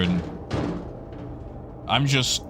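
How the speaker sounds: normal talking voice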